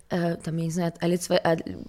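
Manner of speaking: ordinary talk, nothing marked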